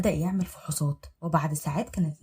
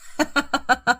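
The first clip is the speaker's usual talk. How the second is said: mocking villain laugh; cruel laugh; 7 semitones higher, 5 dB louder than usual. Husband laughing cruelly at wife. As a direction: laugh at her coldly.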